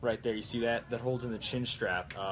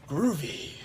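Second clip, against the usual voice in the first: Ash; 4 semitones higher, 4 dB louder than usual. Acted. Cosplayer doing Ash on request for viewers, groovy impression. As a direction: put on an action hero's one-word catchphrase. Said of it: "best Ash impression right now".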